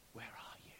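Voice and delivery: low, soft whispered question; God's whisper. Retold or imitated. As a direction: whisper it gently into the hiding place. God calling to hiding Adam.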